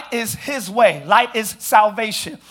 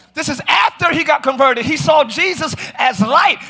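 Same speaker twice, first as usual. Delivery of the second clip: projected sermon voice, punching key phrases; emphatic preaching; 5 semitones higher, 4 dB louder than usual. Real, not acted. Preacher recounting Paul knocked off his horse, emphatic preaching. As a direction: pile up each phrase with force.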